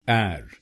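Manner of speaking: एर said as open air sound; first syllable. Shown as a demonstration. Teacher isolates the syllable, एर as air. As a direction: say एर like English air. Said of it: "बोलिए एर".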